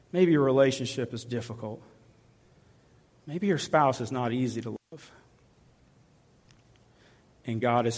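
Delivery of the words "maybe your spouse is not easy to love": normal talking voice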